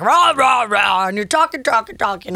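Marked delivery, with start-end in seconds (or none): 0.0-1.1 noisy "rah rah" imitating nonstop talk; hammed-up blabbering noise; mock loud chattering. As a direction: blare out nonsense chatter. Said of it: "when you're like".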